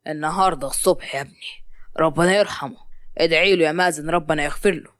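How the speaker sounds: low-pitched, subdued father; solemn consolation. Acted. Father asks grieving Mazen to pray, solemn consolation. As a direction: console him in a deep, hushed voice.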